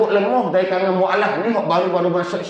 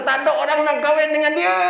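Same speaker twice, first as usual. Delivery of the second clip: high, jeering mock holler; high-pitched mocking call; mock yell; 12 semitones higher, faster than usual. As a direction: imitate an aunt crying out gossip. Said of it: "dia teriak lagi".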